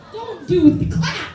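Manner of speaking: loud, slow laughing plea to the crowd; laughing call to stop clapping; laughing shout. Real, not acted. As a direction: wave off the applause with a laugh. Telling crowd not to clap.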